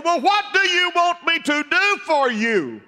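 high, loud preacher's dramatized question; shouted question. Acted. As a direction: call the question out at full preaching voice. Acted reply of Jesus, preached loudly.

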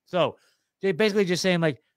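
ordinary talk, nothing marked

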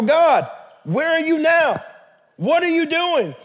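imitated demanding prayer, stretching the questions; mock pleading. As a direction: call out to God in acted exasperation. Acted complaint to God.

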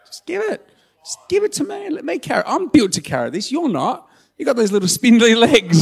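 very high-pitched, playful urging; insistent, squeezed-high comic coaxing; God. Retold or imitated. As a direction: plead like an eager dad offering to help. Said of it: "God's like".

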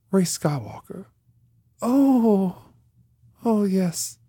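hushed, slow exchange with long pauses; acted movie dialogue. Podcaster acting out Rey giving her name, hushed character voices. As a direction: play both characters softly, spaced out. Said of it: "And she's like".

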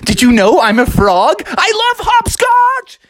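loud, rising to a high squeal; excited outburst. Acted. Froggy thrilled about hopscotch, loud excited squeal. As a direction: burst out with glee, drawing out the end.

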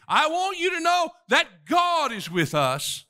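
rising preacher's fervor; building sermon. Acted. Preacher building toward David's words for the congregation.

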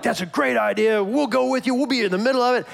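high, eager, pumped-up agreement; eager sons. Retold or imitated. Eli's two sons eagerly agree to carry the ark into battle. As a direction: jump at the idea, all in. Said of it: "Hophni and Phinehas are like".

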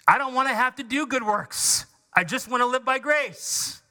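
honest churchgoer. Retold or imitated. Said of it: "someone in a real moment of honesty put it".

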